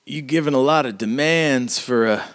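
high-pitched, mocking character voice; scoffing mayor. Mayor to the woman demanding he resign, high and scoffing. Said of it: "and he's like".

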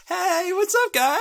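high, loud cartoon critter voices; perky little animals. Animals greeting everyone before magic, high and perky. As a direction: squeak out a cheery greeting.

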